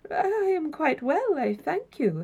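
high, slow, courteous reply; measured old-fashioned formality; somewhat formal. A narrator's reading. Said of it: "said the other man with some formality".

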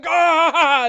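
long, high, loud "ah"; cry of pain. Acted. Orc slammed in the leg, crying out in pain. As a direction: scream as a blade hits you. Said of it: "And he cries out".

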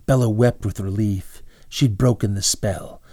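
ordinary delivery, nothing marked

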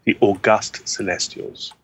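August said aw-GUST, stressed on the second syllable; slow, careful August, as the adjective, unlike the month; deliberately correct pronunciation. Shown as a demonstration. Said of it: "I'm gonna say this correctly".

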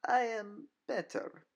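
a predator toying with her prey; Nina's cool, mocking voice. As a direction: villainess purring her taunt. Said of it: "she stated".

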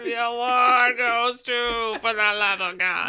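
put-on strained high old-man baby voice; a creaky tired baby presenting; weary old child. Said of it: "sounds like an old man trying to get out".

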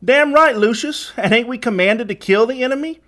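high, loud call of agreement; rowdy henchman calling out. A narrator's reading. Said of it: "one calling out".

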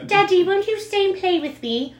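put-on high, pleading child voice; high-pitched little-kid plea; little girl. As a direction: play a small child begging Daddy. Voices little girl asking her father.

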